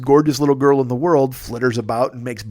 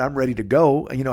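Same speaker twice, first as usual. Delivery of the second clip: ordinary talk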